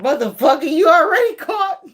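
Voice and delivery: rising to a high, loud jeer; gleeful high-pitched call-out; mocking call-out. Real, not acted. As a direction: crow it at someone already busted. Calling out the caught person.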